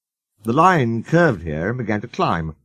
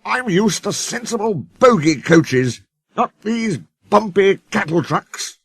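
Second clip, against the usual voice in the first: complaining; 9 semitones higher, slower than usual. A narrator's reading. Sir Handel complaining.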